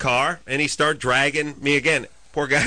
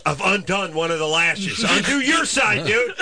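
retold yell; 7 semitones higher than usual. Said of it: "runs around to me and yells".

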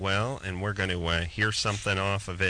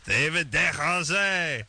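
mock French accent; 7 semitones higher, slower than usual. Host announcing the guest's full name, mock French. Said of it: "these guys are French Canadian".

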